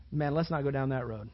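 ordinary delivery

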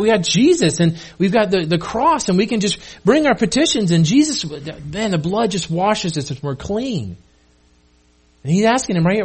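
usual speaking voice

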